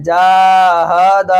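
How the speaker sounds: "जाहद" as jaa-haa-daa, every vowel dragged; wrongly stretched endings. Shown as a demonstration. Teacher showing how not to read, all vowels stretched. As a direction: lengthen the ha and da of "जाहद" too. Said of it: "ऐसे नहीं पढ़ना है".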